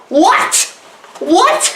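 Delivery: high rising yelp of disbelief; mock astonishment. Reviewer floored by filmmaking cliche breakage, mock astonished. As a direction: play up being floored, pitch leaping up. Feigned shock at broken cliches.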